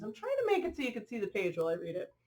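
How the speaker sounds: ordinary delivery, nothing marked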